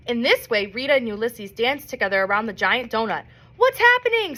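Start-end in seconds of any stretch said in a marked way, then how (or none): 3.6-4.4 high alarmed shout. A narrator's reading. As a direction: call out in alarm. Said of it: "someone shouted".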